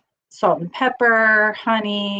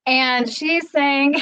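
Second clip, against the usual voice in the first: ordinary talk, nothing marked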